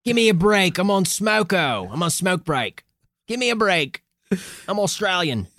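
mock Aussie worker on a break; Australian accent. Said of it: "That's Art the Australian".

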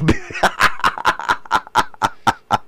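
soft, silent wheezing laugh; breathy wheeze laugh. Real, not acted. Laughing at his own joke.

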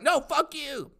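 squeaky high mock dog yell; Frank the dog yelling back. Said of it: "And Frank's like".

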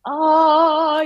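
high, loud pleased oh; delighted oh. Retold or imitated. Actor recalling the character's growth, high delighted oh. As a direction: realize it with delight. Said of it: "it's just like".

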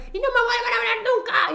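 high-pitched mock fed-up dad; exasperated mock outburst; retold yelling. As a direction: imitate a dad's parting yell before hanging up. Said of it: "y se lo pasa gritando".

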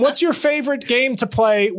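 ordinary delivery

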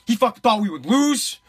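ordinary talk

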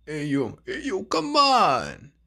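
lightly voiced greaser boss; tough greaser. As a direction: play the cocky leader. Danny protesting.

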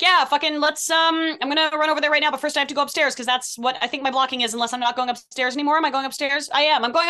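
high, loud, scattered castmate voice, fast in places; hammed-up actress unsure of her blocking; imitating Kat. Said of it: "And then Kat will be like".

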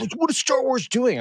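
high-pitched mock-panicky fan voice; fretting fans. Co-host mimicking everyone waiting on Star Wars, mock fretting. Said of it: "everyone's sitting here being like".

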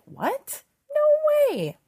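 high, squealed mock disbelief; happy surprise. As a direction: act out delighted shock, shooting way up high. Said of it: "happy surprise ... and be like".

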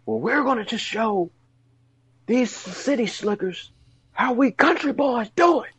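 high, hammed-up country boy voice. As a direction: ham up a country boy promo. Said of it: "picks up the microphone and says".

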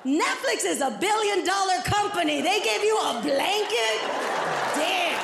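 drawn-out comic incredulity; incredulous cop. Retold or imitated. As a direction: play the cop scandalized by a cheap gift. Cop disbelieving the gift.

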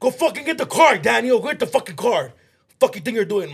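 high, loud imitation of a bossy older sister; retold yelling. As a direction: bark orders at mom like the older sister.

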